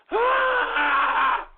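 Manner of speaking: loud crying yell; anguished wail. Acted. Host breaks down over trolls, anguished wail. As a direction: let out a sobbing cry.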